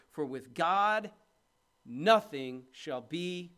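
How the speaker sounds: slow, drawn-out lead for the crowd to finish; audience prompt. Shown as a demonstration. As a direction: leave the line hanging so they answer.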